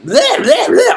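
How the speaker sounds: high-pitched squawked gibberish; mocking hype noises. Speaker telling students not to overdo hype, mock squawking. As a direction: squawk a parody of nonstop hype. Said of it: "gotta be like".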